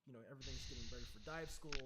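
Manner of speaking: ordinary delivery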